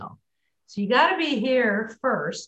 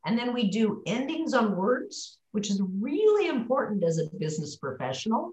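ordinary talk, nothing marked

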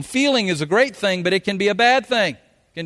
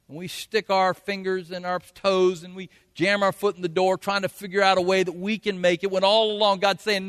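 normal talking voice

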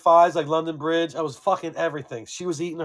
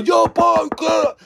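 high, loud, slowed bro voice; impatient buddy; 13 semitones higher, 6 dB louder, slower than usual. Retold or imitated. Friend knocking for Paul, loud high mock bro voice. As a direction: drag it out like the friend banging on the door.

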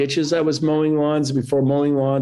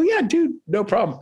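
much higher, airy "Yeah, dude" easing down; breezy forgiving reply; 9 semitones higher than usual. Retold or imitated. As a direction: toss it off lightly, happy to let it go. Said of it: "I go".

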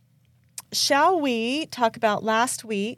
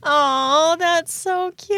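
high, slow, gushing "so cute"; drawn-out, adoring coo; 7 semitones higher, 4 dB louder, much slower than usual. Real, not acted. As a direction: melt over how sweet it was. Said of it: "reacting to the story".

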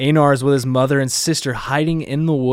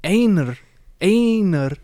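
1 Japanese anime-style Einar; 9 semitones higher, 3 dB louder, slower than usual. Shown as a demonstration. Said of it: "That's actually how they say it".

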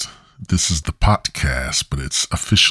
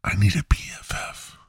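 whispered plea; 7 dB quieter than usual. Pretend. Flirty pickup line continues.

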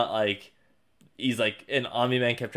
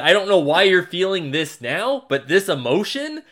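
higher, louder pointed lecture; Mark scolding; 6 semitones higher, 5 dB louder than usual. Retold or imitated. Mark lecturing Omni-Man about Earth, louder higher scolding. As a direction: throw it back in his face. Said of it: "He's like".

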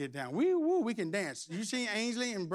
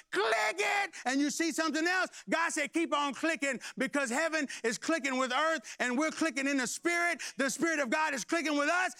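preaching at full force; 6 semitones higher than usual. Real, not acted. Preacher rousing his church, full force. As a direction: preach it to the rafters.

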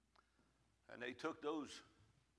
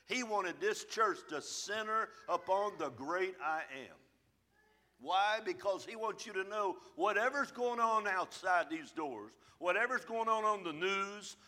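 preaching; 5 semitones higher, 5 dB louder than usual. Real, not acted. Preaching to congregation.

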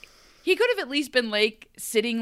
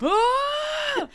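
sudden high yelp of protest; shout; 5 dB louder than usual. Real, not acted. Co-host reacts to the Belle line, real high yelp.

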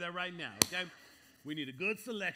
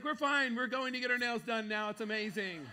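higher, louder carefree wife voice; breezy wife; 6 semitones higher, 7 dB louder than usual. Retold or imitated. Wife says they are fine, breezy higher carefree voice. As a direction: brush it off cheerfully. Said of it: "She's like".

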